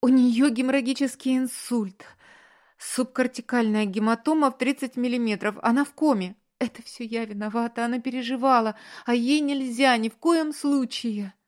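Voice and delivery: high, loud, crying outburst; distraught wail of guilt and fear; tearful hysteria. A narrator's reading. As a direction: pour it out through tears, panicking. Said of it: "начала плакать".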